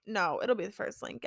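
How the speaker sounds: ordinary talk, nothing marked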